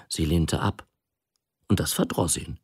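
ordinary delivery

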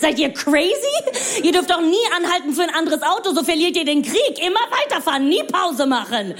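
be scandalised by polite drivers; exasperated comic outrage; mock outrage. Outraged at zipper-merge rule.

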